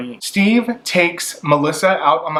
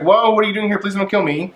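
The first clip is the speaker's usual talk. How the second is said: scared; 7 semitones higher, faster than usual. Retold or imitated. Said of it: "And she's scared".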